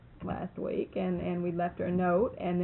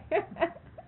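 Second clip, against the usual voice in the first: laugh; 10 semitones higher than usual. Real, not acted. After the punchline.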